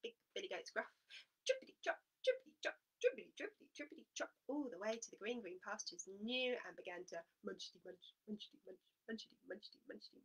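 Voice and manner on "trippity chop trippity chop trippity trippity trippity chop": high trippity chop; high hoof chant. Acted. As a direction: trot it out high. Hooves of middle goat.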